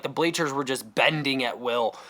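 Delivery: ordinary talk, nothing marked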